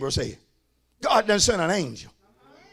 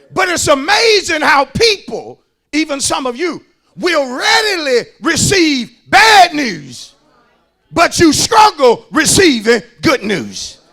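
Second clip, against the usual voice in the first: slow, emphatic sermon delivery; full-force preaching; 5 semitones higher, 9 dB louder, slower than usual. Acted. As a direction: stretch out the key words for the congregation.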